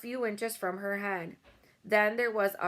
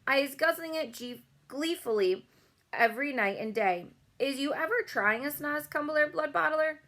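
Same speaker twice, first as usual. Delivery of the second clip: lightly voiced kindly giant, slightly higher; BFG voice; 6 semitones higher than usual. A narrator's reading. The BFG leading Bloodbottler away from Sophie, cheerful giant voice. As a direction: sell the treat with mock enthusiasm.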